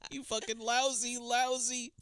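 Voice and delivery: high mock-melodramatic accusation; imitated high-pitched outrage; hammed-up anguish. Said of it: "Oscar clip".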